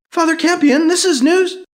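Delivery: high, loud pushy caller; eager reporter. Acted. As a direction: rush the question. Reporter calling.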